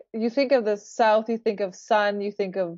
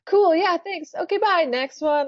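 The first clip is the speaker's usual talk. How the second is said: higher, rushed interview goodbye; hurried sign-off; 7 semitones higher than usual. Retold or imitated. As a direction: mimic rushing off the call. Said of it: "And then, like".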